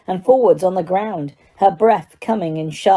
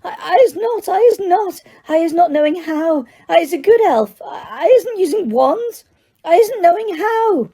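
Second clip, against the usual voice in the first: very high, frantic elf squeak; squealing terror; 11 semitones higher than usual. A narrator's reading. Terrified elf insists she is innocent, squealing. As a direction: stammer denials in a tiny squeal. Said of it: "she squealed, her eyes rolling in terror".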